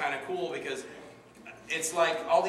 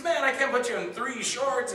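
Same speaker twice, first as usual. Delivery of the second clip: higher, louder, drawn-out mock protest; 10 semitones higher, 6 dB louder than usual. Director turns down actor's request for a third role, protesting. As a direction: refuse in exaggerated exasperation.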